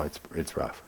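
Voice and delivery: ordinary delivery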